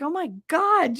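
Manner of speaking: high-pitched disbelief; mock shock. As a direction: imitate an astonished friend. Friend's shocked reaction, acted out.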